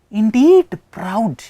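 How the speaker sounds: INDEED PROUD slow, high and loud; emphatic stress. Shown as a demonstration. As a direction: hammer "indeed proud" with stretched words. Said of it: "adding some more information with emphasis".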